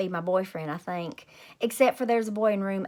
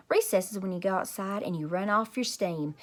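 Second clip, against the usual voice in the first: ordinary delivery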